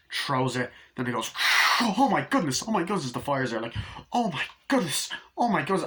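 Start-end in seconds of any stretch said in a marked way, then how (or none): 1.8-3.1 high-pitched flustered cries; mock panic. Acting out panic at spreading fire. 4.1-5.8 high-pitched flustered cries; mock panic. Acting out panic at spreading fire.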